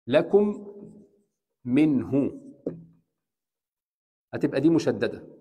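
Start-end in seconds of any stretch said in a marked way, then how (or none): 0.1-2.4 لكم منه with the m merged and doubled; idgham of meem. Shown as a demonstration. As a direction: join the two m's into one held nasal m. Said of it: "طب بعدها ميم". (لكم منه بإدغام الميم وغنة)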